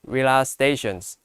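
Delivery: normal talking voice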